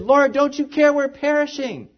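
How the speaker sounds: high pleading cry for help, lightly acted; a mild mock cry of fear. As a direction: voice the frightened disciples calling out. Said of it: "They cried out to Him".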